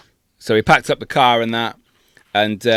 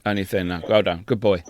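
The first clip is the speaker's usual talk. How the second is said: soft, low patronising coo; patronising praise; 6 semitones lower, 9 dB quieter than usual. Pretend. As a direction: praise him like a dog. Mock praising the other.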